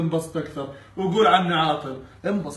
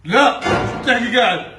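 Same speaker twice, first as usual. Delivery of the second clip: loud protest; 5 semitones higher, 5 dB louder than usual. Pretend. Brother protests at guest's suspicion.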